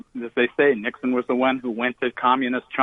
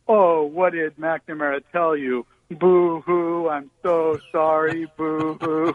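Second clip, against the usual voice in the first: mock-weeping with drawn-out boo-hoos; mocking, sing-song crybaby whine; Henry Kissinger's fake crying; 4 semitones higher, slower than usual. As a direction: put on crocodile tears, higher-pitched wail. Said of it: "started crying crocodile tears and rubbing his eyes".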